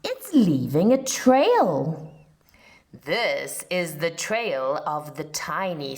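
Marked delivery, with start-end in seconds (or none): 0.0-1.7 put-on louder eager child voice; a hint of wide-eyed kids; excited children. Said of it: "say the children".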